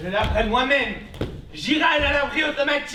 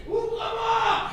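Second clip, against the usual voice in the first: shouting; 8 semitones higher, slower than usual. Acted. Son demands door opened.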